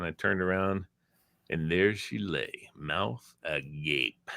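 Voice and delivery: milk the pause like a sleazy radio storyteller; mock-dramatic slow reveal; slow dramatic telling. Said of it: "retold radio bit, dramatic narration".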